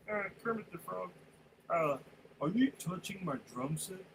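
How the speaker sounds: a hint of John C. Reilly by dropping Kermit's voice. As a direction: Kermit pushed down into a gruff guy. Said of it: "you kinda drop it down".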